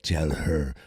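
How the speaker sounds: low villain command; deep and menacing; Vecna. Retold or imitated. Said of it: "And he's like".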